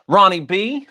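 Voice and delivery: calling the name out loud and high; yelling. Real, not acted. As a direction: shout the name into the mic. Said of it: "Sorry, yelling into the microphone".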